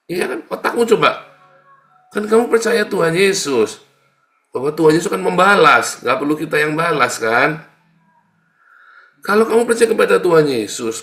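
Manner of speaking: loud, high, insistent sermon rebuke; preaching at full force. Acted. Preacher challenging believers who hold grudges, loud and relentless. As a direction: keep pounding the question at them.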